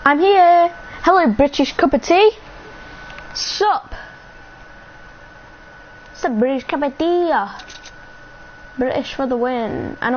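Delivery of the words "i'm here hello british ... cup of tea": loud, high hello to a new viewer; cheerful loud hello to a viewer; excited greeting. Real, not acted. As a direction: burst in happily greeting them. Greeting a viewer who arrived.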